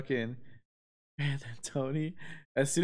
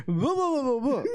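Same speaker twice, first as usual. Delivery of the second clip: confident nonsense pitched way up; stoned babbling; 8 semitones higher than usual. Retold or imitated. Stoned friend marching up to guard: high mock babble.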